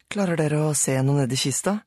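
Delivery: Sara asking. A narrator's reading. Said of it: "spurte Sara".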